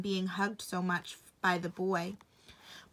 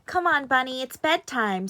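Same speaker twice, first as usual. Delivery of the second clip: put-on louder, higher child calling; boy calling bunny to bed; 4 semitones higher, 7 dB louder than usual. A narrator's reading. Boy fetching his rabbit for bed, loud and high. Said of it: "said the boy".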